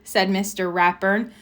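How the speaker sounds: plain reading voice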